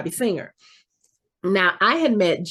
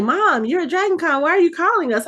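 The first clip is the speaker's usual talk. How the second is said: higher-pitched, lightly voiced questioning kids; puzzled kids; 9 semitones higher than usual. Daughters question their mom at Dragon Con, lightly imitated puzzled kids. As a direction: imitate daughters asking mom why she called. Kids answer mother's unexpected call.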